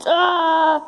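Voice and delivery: startled shout as he trips; yelp, caught off guard by a trap; tripping and falling. Real, not acted. Trap springs on him.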